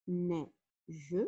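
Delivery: "Neige" in two separate beats, nei-ge; split into syllables. Shown as a demonstration. Teacher counting syllables for pupils, "Neige" split as nei-ge. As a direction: sound out "Neige", pausing before a voiced final ge. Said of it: "j'ai deux syllabes".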